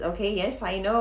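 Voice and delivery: ordinary talk, nothing marked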